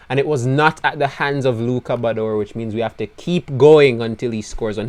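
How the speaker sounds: usual speaking voice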